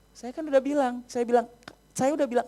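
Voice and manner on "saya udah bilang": usual speaking voice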